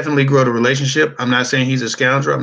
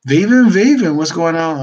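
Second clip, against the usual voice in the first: high, loud call-out of a name; excited greeting; 7 semitones higher, 6 dB louder than usual. Real, not acted.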